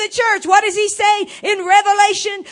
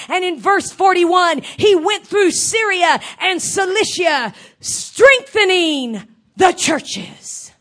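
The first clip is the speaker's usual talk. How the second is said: loud, rising fervent preaching; evangelical preacher; 3 semitones higher, 4 dB louder than usual. Real, not acted. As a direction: preach it with full fervor. Preaching scripture at full force.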